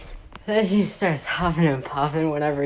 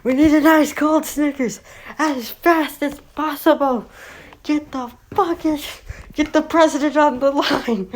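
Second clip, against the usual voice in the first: panicked cop chief; 11 semitones higher than usual. Retold or imitated. Police chief orders ice cold Snickers, frantic high squeal.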